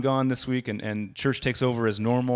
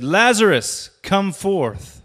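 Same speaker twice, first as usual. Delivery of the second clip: loud, higher call into the grave; Jesus calling out; 10 semitones higher, 8 dB louder, much slower than usual. Retold or imitated. Jesus calling Lazarus from the grave, loud summons. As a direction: summon him out, ringing. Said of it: "he called Lazarus out of the grave".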